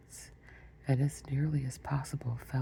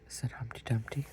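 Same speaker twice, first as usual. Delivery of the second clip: plain reading voice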